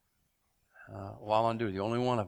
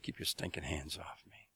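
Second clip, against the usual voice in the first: hissed whisper, low and menacing; whispered threat; 7 semitones lower, 12 dB quieter than usual. Retold or imitated. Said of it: "He just said".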